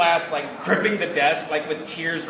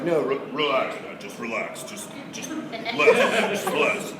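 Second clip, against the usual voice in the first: director. Retold or imitated. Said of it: "Chris Sabat's like, it was directing".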